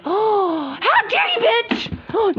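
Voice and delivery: high, slow, crying wail of betrayal; gasp, then wail it out like a wronged doll; dramatic pretend crying; mock sobbing outrage. Doll betrayed, gasping cry.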